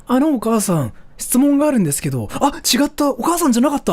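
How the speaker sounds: put-on high, quick flustered kid voice. Kid calling teacher Mom, flustered and rushed. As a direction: blurt it, then catch yourself. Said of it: "tell her this one".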